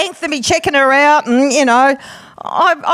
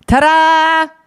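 mock fanfare; 2 semitones higher than usual. Announcing the word.

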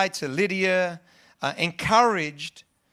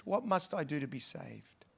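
softer, an almost whispered plea; hushed prayer; 4 semitones lower, 12 dB quieter than usual. Shown as a demonstration. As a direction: ask it again under your breath. Prayer repeated, much softer.

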